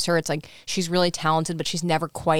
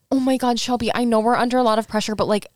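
higher, louder imitation of alarmed pleading; shocked concern; 5 semitones higher, 4 dB louder than usual. Retelling Caitlyn confronting Shelby over the syringe, shocked concern. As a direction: act out a shocked, rushed appeal.

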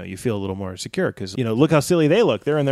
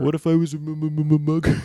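higher voice stuck on the m; mocking stammer; 4 semitones higher than usual. Teasing friend about being the nervous mugger, mockingly. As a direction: stutter the m over and over, teasing. Mocks stammering question asker.

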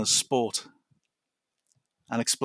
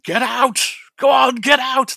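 shouted, much higher and louder Australian "Get out!"; Janet Fielding impression; 14 semitones higher, 10 dB louder, slower than usual. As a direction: bark it like an Australian actress throwing someone out. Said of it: "Your Janet Fielding impression".

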